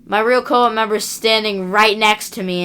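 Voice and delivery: normal talking voice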